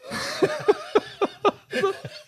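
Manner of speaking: real high-pitched cackle; a squealing fit of laughter. Real, not acted. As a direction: burst out laughing. Laughing at his own story.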